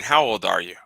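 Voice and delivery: ordinary talk, nothing marked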